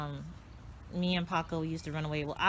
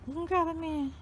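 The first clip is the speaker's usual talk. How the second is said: much higher, cheering the dog on; high encouraging; 10 semitones higher than usual. Real, not acted.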